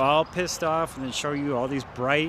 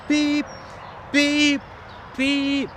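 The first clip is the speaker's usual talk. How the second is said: high sharp beep beep beep; three shrill horn honks; imitated car horn beeps; 10 semitones higher, much slower than usual. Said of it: "like that".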